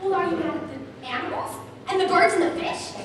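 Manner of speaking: ordinary delivery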